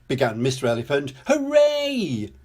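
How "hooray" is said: high-pitched drawn-out cheer. A narrator's reading. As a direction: cheer in celebration. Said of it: "cheered everyone loudly".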